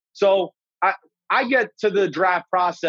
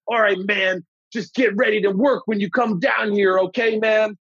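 put-on coach voice, higher pitched; John Gruden; 4 semitones higher than usual. Coach telling new pick to get ready, imitated voice. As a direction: imitate the coach signing off the call. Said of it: "this is John Gruden".

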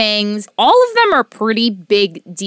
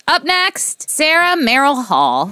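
usual speaking voice

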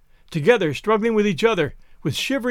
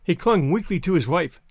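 plain reading voice

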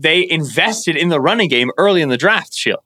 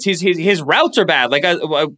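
usual speaking voice

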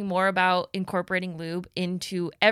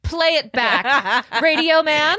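loud demand; 5 semitones higher, 8 dB louder, slower than usual. Real, not acted. Demanding replay from producer.

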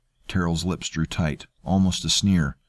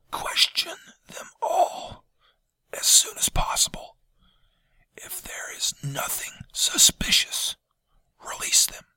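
hoarse, pained whisper; 3 dB quieter than usual. A narrator's reading. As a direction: force out a raw, painful whisper. Janus's wounded whisper again.

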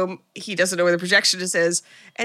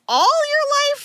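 much higher, mock disbelief; incredulous echo; 3 dB louder, slower than usual. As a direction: echo it back in shocked disbelief. Mock echo of doctor's line.

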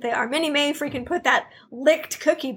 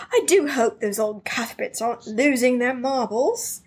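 lightly voiced snooty posh accent; mock posh accent. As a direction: sniff it out like a stuffy rich neighbor, posh accent. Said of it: "in his little freaking accent".

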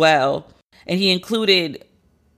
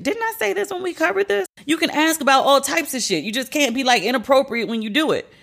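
much higher, indignant reminder; incredulous; 7 semitones higher than usual. Real, not acted. Host to listeners, incredulous and high. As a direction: pitch it up in disbelief.

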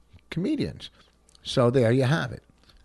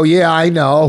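loud mocking yeah I know; mock the bored interviewer; mocking dismissive; 6 semitones higher, 11 dB louder than usual. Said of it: "You go".

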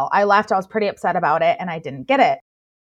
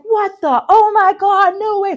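high-pitched squeal of disbelief, much higher; shocked disbelief; 12 semitones higher, 7 dB louder than usual. Retold or imitated. Retelling the second callback, squealing in shock. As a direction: squeak out total shock at the news. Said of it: "I'm like".

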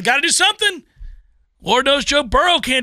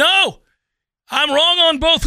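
sudden correction; 8 semitones higher, 5 dB louder, slower than usual. Real, not acted. Catching his own mistake.

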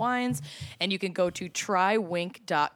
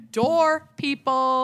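excited; 8 semitones higher, 7 dB louder, much slower than usual. Real, not acted. Hyping sponsor, louder higher.

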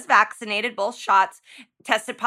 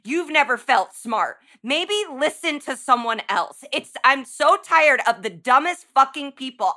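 usual speaking voice

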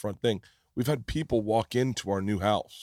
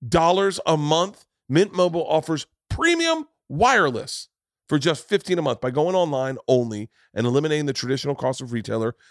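upbeat, higher sponsor read, pushing the deal; 4 semitones higher, 4 dB louder than usual. Acted. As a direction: sell it like a radio spot, bright and eager. Host reads a sponsor ad.

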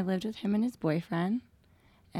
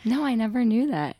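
louder, higher, right on the mic; 4 semitones higher, 8 dB louder than usual. Real, not acted. Guest repeating herself for the audience, loud into mic. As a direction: repeat it up loud so listeners can hear. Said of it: "Now the people can hear you".

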